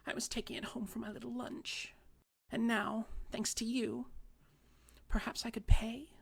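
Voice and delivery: put-on a wronged old lady sulking; fussy little old lady. A narrator's reading.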